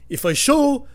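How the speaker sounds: high, loud put-on character voice; customer's imagined thoughts. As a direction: reason it out in the customer's silly high voice. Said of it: "What is he thinking in his head?".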